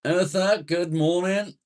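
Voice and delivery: very high, loud singsong hello; calling out greeting. Real, not acted. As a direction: sing out the greeting to a new arrival. Hailing a viewer joining the stream.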